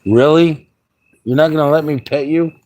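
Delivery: high, loud pet voice; cat talk. Real, not acted. Talking to the cat.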